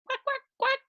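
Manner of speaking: high-pitched quacking duck; high duck quacks. Pretend. Duck sound for kids.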